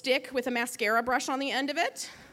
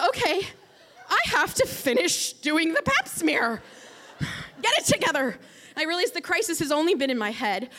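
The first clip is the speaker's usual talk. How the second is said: ordinary talk, nothing marked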